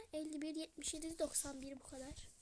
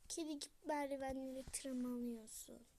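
normal talking voice

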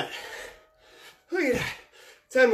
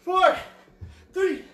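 shouted countdown; 13 semitones higher than usual. Real, not acted. Instructor shouts the final seconds to her tired class. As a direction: drive them through the last seconds.

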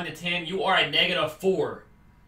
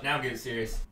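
usual speaking voice

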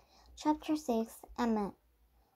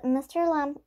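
ordinary delivery, nothing marked

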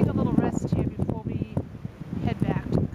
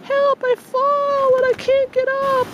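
mock cry for help; 14 semitones higher, 5 dB louder, slower than usual. Hammy cry from the ice.

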